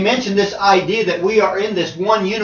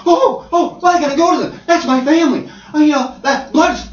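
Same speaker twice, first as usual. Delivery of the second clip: gushing, much higher and louder, hammed-up; mock family devotion; 7 semitones higher, 6 dB louder than usual. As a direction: overplay the devoted family man. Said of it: "one of them said to him".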